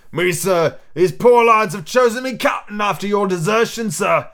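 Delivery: rolling pirate captain voice; Long John Silver. A narrator's reading. Pirate explaining his promotion to the captain, pointed. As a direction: put on a pirate voice, leaning on "desertion". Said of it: "laying a particular emphasis upon the word desertion".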